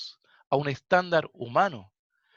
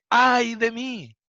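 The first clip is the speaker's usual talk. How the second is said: exclaiming; 5 semitones higher, 4 dB louder, much slower than usual. Acted. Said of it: "Y él exclama y dice".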